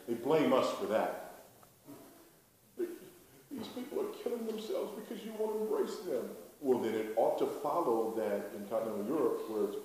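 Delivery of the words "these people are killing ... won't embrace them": higher-pitched mock complaint from the other side; imitated accusation. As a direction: plead it in a high mimicking voice. Voicing the other side's accusation.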